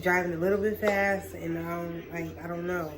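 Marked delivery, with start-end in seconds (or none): none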